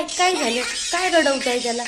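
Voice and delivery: ordinary talk, nothing marked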